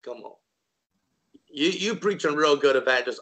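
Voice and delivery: ordinary delivery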